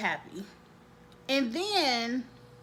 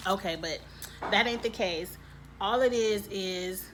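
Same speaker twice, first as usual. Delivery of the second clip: usual speaking voice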